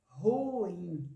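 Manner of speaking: "Ruim" como ru-im, com tônica no im; duas sílabas. Shown as a demonstration. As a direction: separe "Ruim" em duas emissões de voz. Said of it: "duas emissões de voz". ("Ruim" alongado, em hiato)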